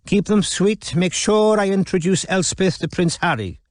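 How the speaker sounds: put-on high, lilting Welsh accent; sing-song Welsh, pitched well up; Geraint. A narrator's reading. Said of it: "Geraint's lilting accent filled the office".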